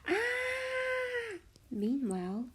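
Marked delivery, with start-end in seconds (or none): 0.1-1.4 short high mock scream of fright; a cartoon mouse shriek, high and brief. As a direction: squeal like a startled mouse. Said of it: "Jerry screamed".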